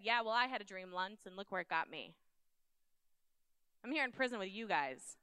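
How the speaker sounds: mild mock sarcasm, a touch sour; lightly voiced, brushing off the dream with bitterness; bitter attitude. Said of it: "That was an attitude that he could have had".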